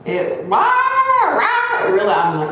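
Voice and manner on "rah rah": high, loud "rah" blurted out; sudden high imitation roar; mock roar. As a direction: imitate a startling blare, pitched way up. Acting out what startled her.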